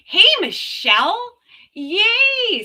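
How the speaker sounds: high, loud cheer of welcome; delighted high-pitched greeting; excited greeting. Real, not acted. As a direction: squeal hello to a friend who just arrived. Spots a friend arriving in chat.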